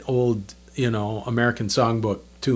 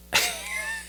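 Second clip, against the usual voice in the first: high, squealing mock laugh; imitated burst of laughter; 9 dB quieter than usual. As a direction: burst out laughing at the silly question. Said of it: "puts the phone down and he's laughing".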